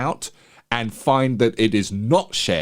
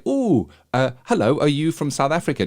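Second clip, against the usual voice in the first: higher-pitched, bright-eyed retold hello; eager greeting; 6 semitones higher than usual. Hopeful newcomer greets a stranger in the shop, eagerly. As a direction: imitate your keener, newcomer self approaching. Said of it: "still bright-eyed and bushy-tailed".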